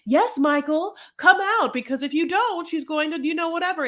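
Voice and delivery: lightly voiced pleading friend; mock Renee pleading. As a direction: coax a man out of the house.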